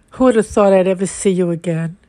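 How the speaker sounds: usual speaking voice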